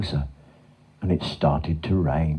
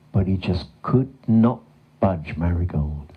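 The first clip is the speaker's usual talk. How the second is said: ordinary delivery, nothing marked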